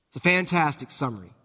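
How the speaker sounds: usual speaking voice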